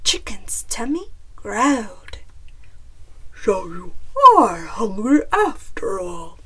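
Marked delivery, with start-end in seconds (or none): 3.4-6.4 low, loud bear voice; amused bear. A narrator's reading. Bear hears Chicken's tummy rumble, low amused bear. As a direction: tease her as a big jolly bear. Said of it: "Bear chuckled".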